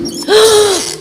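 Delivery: high startled gasp. Acted. Woman sees the empty chair rocking, startled gasp.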